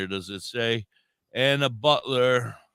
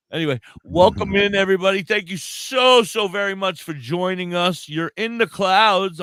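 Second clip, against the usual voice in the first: hyped welcome; 7 semitones higher, 6 dB louder than usual. Real, not acted. Welcoming the audience.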